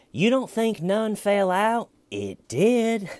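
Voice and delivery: high, loud, slow at the end; hammering interrogator. Retold or imitated. Red Shirt scratching his own hair at the suspect, loud and high. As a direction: drag out each Look, Steven to rattle him. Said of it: "he goes".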